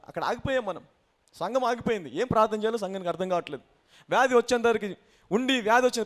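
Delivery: normal talking voice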